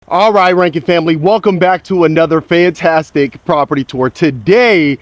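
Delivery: loud, upbeat host welcome; vlog intro. Acted. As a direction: greet the viewers with big energy. Energetic opening of the tour.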